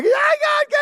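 very high, loud wailing screech; mock screaming. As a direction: wail wildly as if being dragged away. Acted screaming as woman is carried out.

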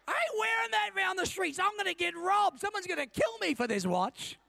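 hammed-up high-pitched panic about being robbed; mock alarm. Preacher tells the congregation he was too scared to wear it, mock alarm. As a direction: play up the fear, loud and high.

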